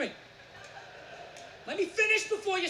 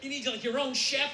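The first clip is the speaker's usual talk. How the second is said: usual speaking voice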